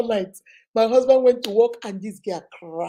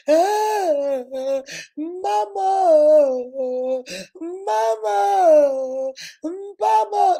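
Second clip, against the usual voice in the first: high, loud drawn-out wail for mama; mock sobbing howl, calling mama; re-enacted bawling; 7 semitones higher, 5 dB louder, much slower than usual. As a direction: wail for your mother, hammed up. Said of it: "I was just crying".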